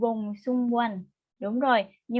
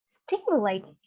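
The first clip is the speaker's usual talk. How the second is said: "stimulated" with the first syllable stressed. Shown as a demonstration. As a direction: say "stimulated" as STIM-u-lated, falling away. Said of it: "it stress on the first syllable".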